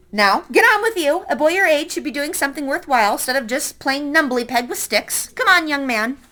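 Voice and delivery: put-on bossy old lady. Old lady orders the boy to pick strawberries, lightly bossy. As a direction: hurry the lazy boy along, lightly bossy.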